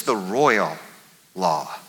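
"royal" drawn out, pause, then low "law"; slow, weighted "royal"; stress on royal. Shown as a demonstration. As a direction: linger on "royal" before dropping onto "law". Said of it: "he doesn't just say the law".

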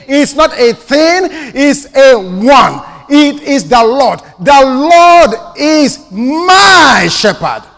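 loud, slow, high climactic declaration; preaching at full force. Acted. Preacher declares his source to the congregation, full-force shout. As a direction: build to a shout on "The Lord is my shepherd".

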